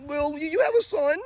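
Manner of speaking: high whiny mock female voice; hammed-up high nagging woman, dragging the last words. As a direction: mimic a whining woman arguing back. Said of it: "Women don't talk".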